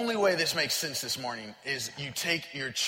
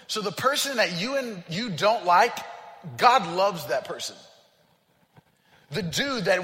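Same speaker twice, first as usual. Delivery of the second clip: louder, driving sermon voice; preaching at full force; 2 semitones higher, 6 dB louder than usual. Acted. Pastor urging the congregation that God loves everyone, louder, full-force preaching. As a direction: preach it to the back row.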